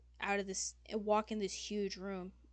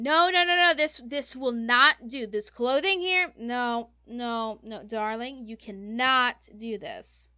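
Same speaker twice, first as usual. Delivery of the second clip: exasperated fashion designer; 2 semitones higher, 9 dB louder than usual. Acted. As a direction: dismiss the work with emphatic, theatrical disdain.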